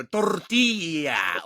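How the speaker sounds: tortilla slowed right down with a Spanish double-l, tor-TEE-ya; hammed-up Spanish accent on one word. Shown as a demonstration. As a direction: roll out Tortilla the Spanish way, slowly. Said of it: "if I go over".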